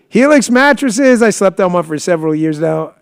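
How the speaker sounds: booming ad-read opener; announcer voice. Acted. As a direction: sell it like a radio host. Ad read opening, announcer style.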